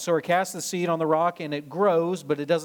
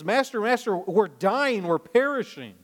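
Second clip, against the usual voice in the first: mock-panicked, pitched higher cry for help; panicked plea; 6 semitones higher than usual. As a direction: plead like the boat is sinking. Disciples wake Jesus in storm.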